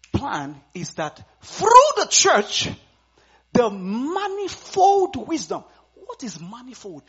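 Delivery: loud, slow fiery preaching. Acted. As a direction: proclaim it from the pulpit. Preaching the scripture at full force.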